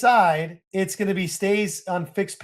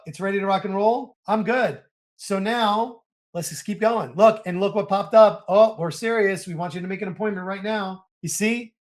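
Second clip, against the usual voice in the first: normal talking voice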